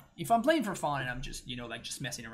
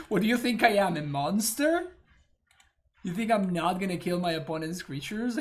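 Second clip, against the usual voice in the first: loud, higher mock indignation; mock outrage; 5 semitones higher, 9 dB louder than usual. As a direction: act offended, hammed up.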